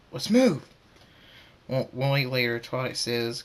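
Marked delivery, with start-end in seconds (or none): 0.1-0.6 high, loud call to action; rallying shout. Acted. Thunderstorm rallies the builders to meet the deadline, rallying shout. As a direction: call out to get the team moving. Leader rallies team to start rebuilding.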